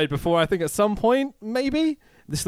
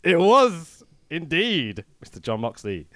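ordinary talk, nothing marked

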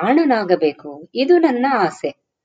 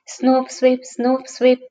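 higher, swishy imitation of rustling skirts; swishing skirt sound; 5 semitones higher than usual. Boy hearing women walk to the shop, imitated skirt swish. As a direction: brush the sounds like passing skirts. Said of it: "ಸ್ಕರ್ಟಿನ ಸಪ್ಪಳ".

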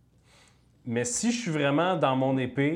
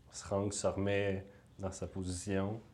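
soft, hushed scene setting; game master narration; 2 semitones lower, 5 dB quieter than usual. Acted.

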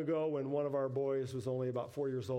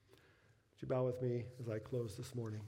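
ordinary delivery

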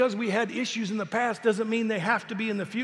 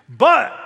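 "But" belted louder as a turning point; stress on But; 3 semitones higher, 11 dB louder than usual. Shown as a demonstration. Preacher reading the verse to the crowd, loud "But". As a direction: land the "But" with force. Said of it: "you need to know where that "but" is".

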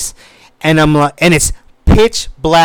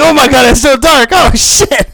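high, giggly exclamation; laughing; 9 semitones higher, 4 dB louder than usual. Retold or imitated. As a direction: laugh through the words in the dark. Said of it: "my cousin and my other brother, like".

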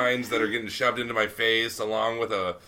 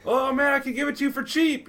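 very high, bright vendor voice; a seller caving in with a squeaky high pitch; eager vendor; 3 dB louder than usual. Retold or imitated. Vendor dropping his price for the tourist, squeaky high.

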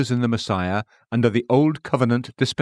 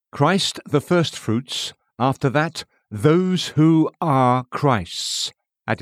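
slow, weighty stress on "those who are Christ's"; emphasis added; 2 semitones higher, slower than usual. Shown as a demonstration. Preacher reading scripture to readers, leaning slowly on "those who are Christ's". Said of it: "emphasis added".

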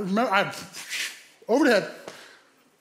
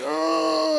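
high, drawn-out mock groan; a long comic moan of pain; imitated groan; 8 semitones higher than usual. Injured man asked to accept prayer, mock groan.

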